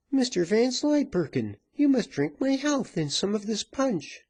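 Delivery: high-pitched bossy woman; domineering woman, pitched high; insistent virago. A narrator's reading. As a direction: press him in a high, commanding voice.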